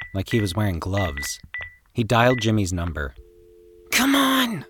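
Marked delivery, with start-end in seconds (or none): 3.9-4.7 sharp high urgent hiss. Acted. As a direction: hiss it impatiently. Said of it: "Danny hissed".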